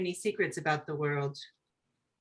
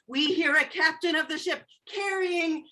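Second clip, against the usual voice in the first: ordinary talk, nothing marked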